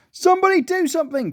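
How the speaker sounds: high-pitched cry for help; high, frantic storybook cry. A narrator's reading. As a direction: plead with the room in a panic. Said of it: "cried Joyce".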